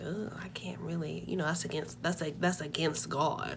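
hushed whisper, slowing at the end; mock pious whisper. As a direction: whisper it like a scandalized grandma. Grandma's disapproval, whispered.

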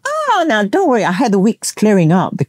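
put-on high, cheery tourist voice, no real accent; reassuring tourist. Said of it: "they had a Spanish accent".

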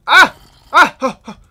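loud high panicked screams; startled screams. Real, not acted. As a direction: scream in sudden fright. Startled by skeletons.

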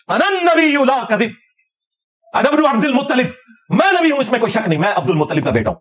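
high-pitched proclamation; battlefield declaration. Acted. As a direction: quote it as a leader declaring himself at the front. Acts the Prophet's battlefield declaration. (जंग के मैदान का ऊंचा ऐलान)

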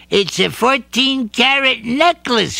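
proud little gift-giver; a hint of bunny voice; lightly voiced Bouncer. A narrator's reading. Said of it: "he said".

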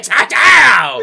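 high, loud gleeful shout; loud mock celebration yell. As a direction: yell it like a score. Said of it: "He's like".

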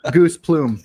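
laughing through the words. Real, not acted. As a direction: burst out laughing as you say it. Laughing at the joke word.

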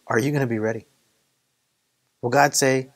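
usual speaking voice

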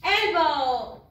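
Elbow loud, falling; one last Elbow for the class; English elbow. Shown as a demonstration. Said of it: "Una más".

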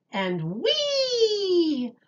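high, bright squeal of joy; gleeful whee. Acted. Storytime reader voicing a yellow-day thrill, high gleeful squeal. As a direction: let the whee fly up like a kid on a swing.

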